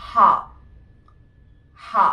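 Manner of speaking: ഹ as a breathy "ha"; German letter H. Shown as a demonstration. Teacher demonstrating German H. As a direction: say ഹ like "hah", not "aitch". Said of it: "എച്ച് നമ്മൾ pronounce ചെയ്യുന്നത്".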